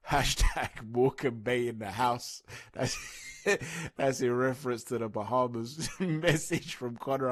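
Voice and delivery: talking through real laughter; amused, letting laughs break into the words; laughing. Real, not acted. Vlogger amused by boxers' tweet spat, laughing as he reads.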